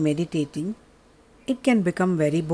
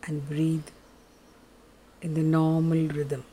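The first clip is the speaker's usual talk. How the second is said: soft, lower, unhurried meditation voice; guided meditation; 4 semitones lower, 5 dB quieter than usual. Acted. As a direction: guide them gently into stillness.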